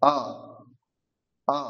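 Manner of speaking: আ sounded from the throat; throat vowel demo. Shown as a demonstration. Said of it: "কণ্ঠ থেকে উচ্চারণ করতে হবে".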